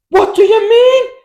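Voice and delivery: very high, loud, drawn-out mock disbelief; hammed-up squawk of astonishment; incredulous reaction. Friend reacting to lad going home with two birds, exaggerated disbelief. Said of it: "that was my reaction".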